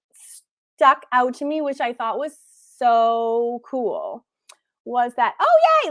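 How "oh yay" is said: excited cheer. Real, not acted. Cheering new sign-up in chat.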